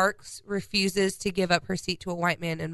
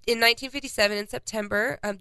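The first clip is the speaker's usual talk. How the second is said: ordinary talk, nothing marked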